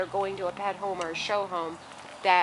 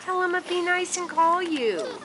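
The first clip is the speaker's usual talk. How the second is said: higher, slow coaxing voice; 10 semitones higher, 4 dB louder than usual. Real, not acted. Mom coaxing kid to call puppies, high.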